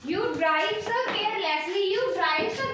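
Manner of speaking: example read slowly, word by word; slow, careful read of the example; slow dictation. Shown as a demonstration. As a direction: draw the sentence out for the class. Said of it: "carelessly, ठीक है।".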